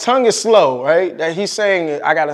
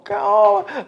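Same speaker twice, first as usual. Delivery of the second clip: high exclaimed cry of awe; sudden outcry. Real, not acted. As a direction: burst out high with feeling.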